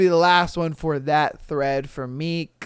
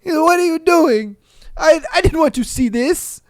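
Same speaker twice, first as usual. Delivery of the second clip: panicked Italian guy; 10 semitones higher, 8 dB louder than usual. Retold or imitated. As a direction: plead like the shocked Italian who walked in. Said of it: "Homeboy opened that door and was just like".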